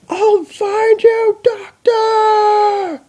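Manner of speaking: very high, slow shrieked vow; top-of-lungs scream. Acted. Chrysalis screeching her vow of revenge at the Doctor. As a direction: screech it to the skies. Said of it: "screamed at the top of her lungs".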